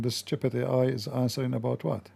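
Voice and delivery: ordinary delivery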